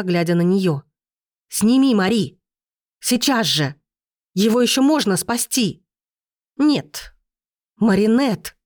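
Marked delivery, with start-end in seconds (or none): 1.5-8.5 restrained desperate cries, pitch jumping up; shouting. A narrator's reading. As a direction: call out in urgent bursts without full volume. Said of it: "закричала я". (сдержанные отчаянные выкрики)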